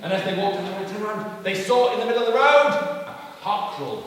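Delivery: high, loud, building narration; excited storyteller reveal; storytelling to children. A narrator's reading. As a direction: announce a new discovery to young listeners.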